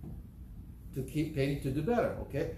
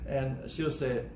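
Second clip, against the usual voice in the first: normal talking voice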